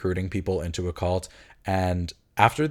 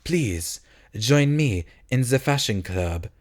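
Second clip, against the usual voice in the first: slow, lightly put-on French accent; slow hint of French accent; mock French accent; 2 semitones higher, slower than usual. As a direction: drawl it like Bebe the Frenchman. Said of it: "His whole presence is like".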